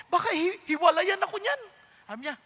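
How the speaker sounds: normal talking voice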